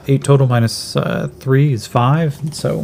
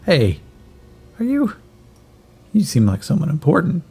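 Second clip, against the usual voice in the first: put-on kid voice; 2 semitones higher than usual. GM voices ragged kid bringing soup.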